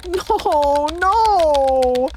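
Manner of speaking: high, desperate no; plead, high. Real, not acted. Begging game.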